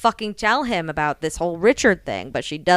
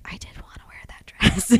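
retold shy whisper; whispered girlish confession; 5 dB quieter than usual. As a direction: breathe it like a confession.

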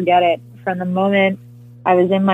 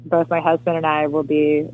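usual speaking voice

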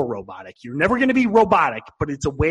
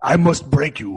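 Drago impression; 3 semitones lower than usual. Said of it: "you do sound a lot like Victor Drago".

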